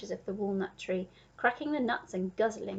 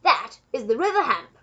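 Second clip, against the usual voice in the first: loud, proud announcement; crying out; 3 semitones higher, 9 dB louder than usual. A narrator's reading. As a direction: proclaim it as a proud duke. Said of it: "cried the Duke".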